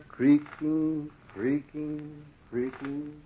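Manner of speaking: slowed, then drifting off to a hush; fading creak. A narrator's reading. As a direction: let each creaking fade into silence. Narrator hears the creaking chair.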